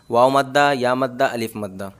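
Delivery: ordinary delivery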